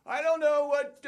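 high-pitched, oddball coach impersonation; odd-talking coach. Retold or imitated. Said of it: "he had a real odd way of speaking".

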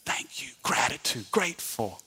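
whispered, breathy and soft; whispered gratitude. Real, not acted. Preacher whispering gratitude to the church. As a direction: breathe the words almost silently.

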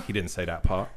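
ordinary talk

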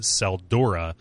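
ordinary talk

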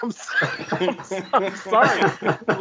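giggling through I'm sorry; high, laughing mock-sorry apology. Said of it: "I'm like".

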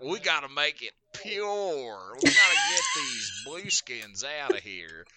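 slow exaggerated Southern accent. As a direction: play the hillbilly bigot in a Southern accent. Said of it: "a Southern accent".

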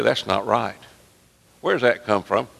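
ordinary talk

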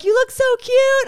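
a hint of gushing women; lightly voiced sweet stranger. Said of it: "So they're like".

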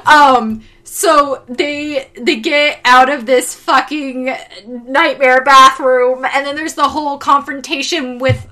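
ordinary talk, nothing marked

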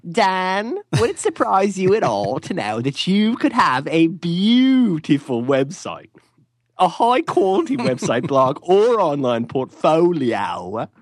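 slow, high late-night pitchman voice; infomercial. Acted. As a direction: oversell it like a TV ad. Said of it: "You sound like an, an infomercial".

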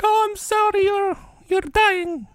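high, slow, hammed-up sympathy; mock weepy visitor.

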